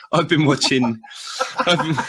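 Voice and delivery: real laugh overtaking the words; breaking into laughter. Real, not acted. Host trying to say what he's been watching, breaking up laughing. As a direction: start the sentence, then crack up.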